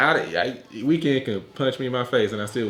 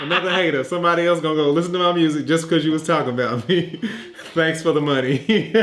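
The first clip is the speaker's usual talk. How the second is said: laughing smug [name]; 5 semitones higher than usual. Retold or imitated. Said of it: "he's like".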